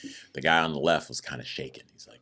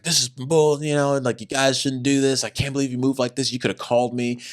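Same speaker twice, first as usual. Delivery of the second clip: railing; 8 semitones higher than usual. Retold or imitated.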